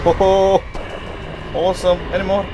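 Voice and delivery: loud, high whoop of triumph; exhilarated. Real, not acted. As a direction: crow over the kills at full voice. Exhilarated after killing spree.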